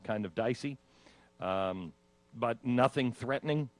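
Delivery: ordinary talk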